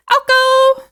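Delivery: high, loud, singsong call of the name; cheerful hail of a viewer's nickname; playful call-out. Real, not acted. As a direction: sing the name out like a greeting. Calling out new nickname.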